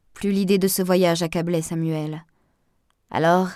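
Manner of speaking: ordinary delivery, nothing marked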